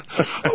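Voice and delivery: squealing giggle; high laugh. Real, not acted. After crashing his motorcycle, a high giggle.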